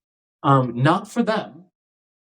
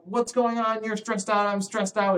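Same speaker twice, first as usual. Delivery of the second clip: put-on higher, anxious kid voice; mock anxious kids; 9 semitones higher than usual. Kids reacting to a panicking teacher, lightly voiced higher worry. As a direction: lightly play worried children catching the stress. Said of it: "and then they're like".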